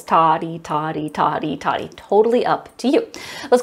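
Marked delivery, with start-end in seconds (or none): 0.0-1.9 low, even syllables on the beat; rhythm syllables. Shown as a demonstration. Teacher offers first grade the syllable option, chanting. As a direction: tick off the pairs in steady time. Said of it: "speak on rhythm syllables".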